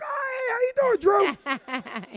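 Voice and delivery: suddenly remember him and gush; sudden fake friendliness. Comedian greets the trooper on the phone, high surprised gush.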